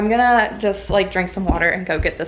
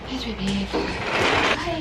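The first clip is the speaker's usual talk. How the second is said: talking to a dog; 6 semitones higher than usual. Real, not acted. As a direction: coo at the dog. Greeting her dog.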